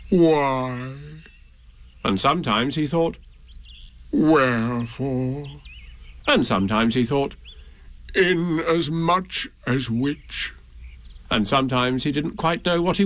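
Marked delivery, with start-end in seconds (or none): 0.1-0.9 sad. A narrator's reading. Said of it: "he thought sadly to himself". 4.1-5.5 gloomy donkey. A narrator's reading. Eeyore's gloomy musing. 8.1-10.5 gloomy donkey. A narrator's reading. Eeyore's gloomy musing.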